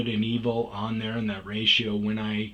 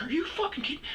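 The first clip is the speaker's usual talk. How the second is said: mock outraged woman, much higher; angry shriek; 5 dB quieter, faster than usual. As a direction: shriek it in her voice. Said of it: "She's like".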